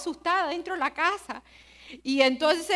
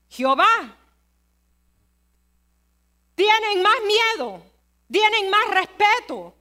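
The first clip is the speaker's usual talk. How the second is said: louder and higher, punching "más"; forceful preaching; 5 semitones higher, 5 dB louder than usual. Real, not acted. Preacher contrasting pagans' fear with believers, loud preaching. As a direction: hammer the point home to the church. Preacher driving home point.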